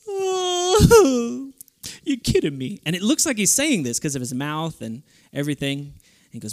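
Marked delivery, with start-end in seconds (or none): 0.1-2.8 high loud wailing dog voice; dismayed talking dog. Retold or imitated. Dog hearing the meat got eaten, hammed-up high wail. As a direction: moan it like a heartbroken dog, hammed-up. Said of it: "And the dog goes".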